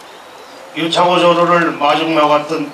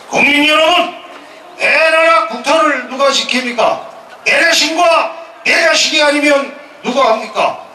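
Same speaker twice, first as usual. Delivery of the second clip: impassioned speech; 5 semitones higher than usual. Real, not acted. Impassioned formal speech to veterans.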